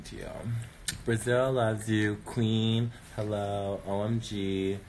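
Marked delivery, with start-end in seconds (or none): none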